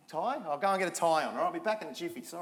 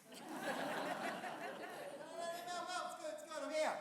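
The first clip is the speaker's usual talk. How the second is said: distant, pitched-up call from far back; calling from off stage; 8 semitones higher, 15 dB quieter, faster than usual. Real, not acted. Host stuck outside calling to the stage, far off. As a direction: shout in from behind the doors.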